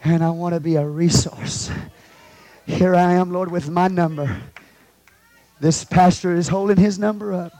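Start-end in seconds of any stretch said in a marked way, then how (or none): none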